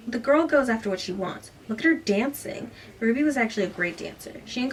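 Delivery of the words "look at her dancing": usual speaking voice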